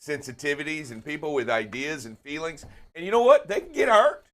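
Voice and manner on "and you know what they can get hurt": high, loud mock-hurt plea; mock wounded protest. Co-host insisting drivers have feelings too, playfully worked up. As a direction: protest in a raised, playfully over-emotional voice.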